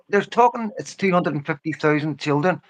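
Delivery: ordinary delivery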